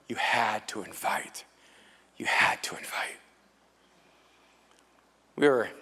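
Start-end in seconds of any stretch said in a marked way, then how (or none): 0.1-1.4 unvoiced whisper, slow; whispered refrain, every word stretched; whispered repeat. Shown as a demonstration. 2.2-3.2 unvoiced whisper, trailing away; fading whisper. Shown as a demonstration.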